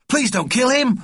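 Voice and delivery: put-on high, pleading boy's voice; crying out. A narrator's reading. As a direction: beg urgently as an upset child. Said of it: "cried Richard".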